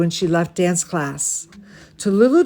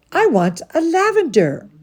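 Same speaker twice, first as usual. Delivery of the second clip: lightly voiced little girl, pitched higher; 8 semitones higher than usual. Little ballerina telling Talulu her tutu colour, in a lightly voiced little girl voice. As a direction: answer like a small eager girl.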